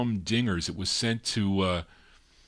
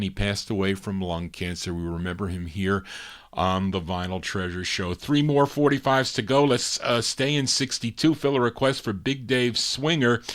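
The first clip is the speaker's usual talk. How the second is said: ordinary talk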